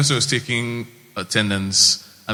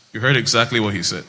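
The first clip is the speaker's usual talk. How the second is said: ordinary talk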